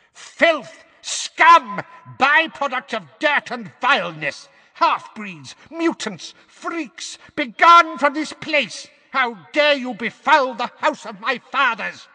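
shrieking very high and loud; old portrait screeching at the intruders. A narrator's reading. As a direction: shriek curses like a mad old woman. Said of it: "she screeched louder than ever".

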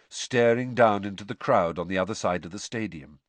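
plain reading voice

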